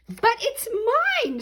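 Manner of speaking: very high, loud shriek; screaming. Acted. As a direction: shriek it to claim the egg. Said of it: "screamed the bird".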